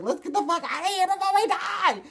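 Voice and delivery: very high, loud mock-panic shriek; panicked scream. Driver to friends during a car chase, panicked scream. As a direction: scream it way up high like fleeing for your life. Retelling panicked escape from follower.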